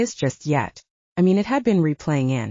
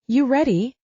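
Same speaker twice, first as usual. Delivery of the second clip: bright eager question, pitched up; enthusiastic; 6 semitones higher than usual. A narrator's reading. Mia eagerly checks if Josh is ready, enthusiastic. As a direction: hype him up before the run. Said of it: "in an enthusiastic tone".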